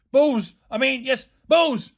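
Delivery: loud, high involuntary outbursts; rattled teacher unable to stop; flustered blurting. A narrator's reading. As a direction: blurt it, correct, blurt again. Teacher helplessly blurting it again.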